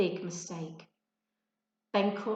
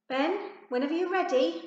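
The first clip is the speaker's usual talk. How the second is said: high, louder, mock-patient teacher; sarcastic drawl; 9 semitones higher, 6 dB louder, faster than usual. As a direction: needle the boy with sarcasm. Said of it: "Mister Archer drawled".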